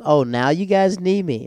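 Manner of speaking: high, loud, slow drawn-out taunt; mock indignation. Friend holding the password taunts the group, mock indignant. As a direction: rub it in, stretching the words high.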